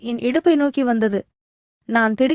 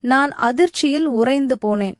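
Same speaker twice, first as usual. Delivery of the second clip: usual speaking voice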